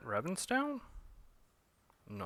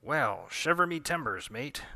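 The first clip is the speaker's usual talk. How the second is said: mock pirate; 4 semitones higher than usual. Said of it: "pretending to be a pirate".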